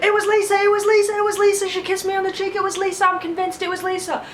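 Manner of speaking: very high, pleading mock voice; frantic accusation. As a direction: blurt out the name in panic. Jam Jam accusing while dragged.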